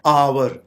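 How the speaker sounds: "आवर" said AA-wur in two syllables; correct pronunciation. Shown as a demonstration. Teacher modelling the correct word, "आवर" as AA-wur. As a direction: give "आवर" a clear w: AA-wur. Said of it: "इसकी correct pronunciation है".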